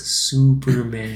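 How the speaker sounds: slow, soft giant's voice; a fan imitating the giant's slow, soft last word; giant's quiet line. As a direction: breathe out the word slowly like the giant. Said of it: "and he just goes".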